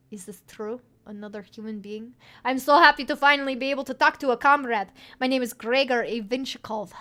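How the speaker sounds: a lightly put-on Russian accent, bursting with joy; hammed-up Russian accent. GM voicing Gregor, thrilled to meet a human, in a Russian accent. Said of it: "my accent is going very bad".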